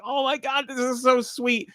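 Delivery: high, tearful gush; choked up. Real, not acted. Fan reacting to sweet kid-like writing, choked up. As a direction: squeak it out, moved almost to tears. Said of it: "I'm getting choked up talking about it".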